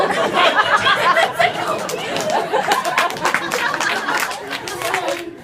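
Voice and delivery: big loud cackle breaking up; long loud laugh. Real, not acted. [name] cracking up at her own story, loud laugh.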